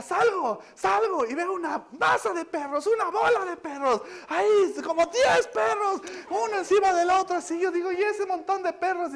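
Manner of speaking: relato agudo e indignado de la esposa; alterada. Retold or imitated. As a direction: imita a alguien escandalizada por lo que vio. Esposa cuenta alterada lo que vio. (agitada, en voz muy aguda)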